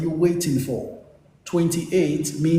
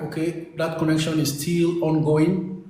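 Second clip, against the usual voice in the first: ordinary talk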